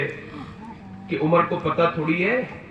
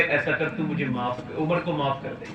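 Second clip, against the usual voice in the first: hushed, lower plea for forgiveness; pleading softly; 6 semitones lower, 7 dB quieter than usual. Acted. Umar humbly asking the widow's pardon, in a soft plea. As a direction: beg quietly, humbled. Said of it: "بعد میں آ کے بولے".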